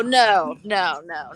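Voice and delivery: normal talking voice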